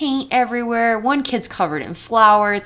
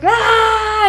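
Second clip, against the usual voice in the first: high, loud mock scream; mimicked scream of someone at breaking point; hammed-up frantic yell; imitated scream; 7 dB louder than usual. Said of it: "he runs out of school screaming".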